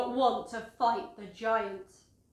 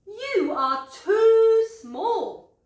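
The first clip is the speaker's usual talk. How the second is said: much higher, louder and very slow, a sing-song kingly voice; King Saul, dismissive; 11 semitones higher, 9 dB louder, much slower than usual. A narrator's reading. King Saul tells young David he is too small, in a high, slow sing-song voice. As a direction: stretch it out like a doubtful king. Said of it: "said King Saul".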